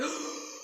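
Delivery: big audible inhale, then blow; deep breath. Acted. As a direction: draw a huge breath for fire. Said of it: "he took another deep breath".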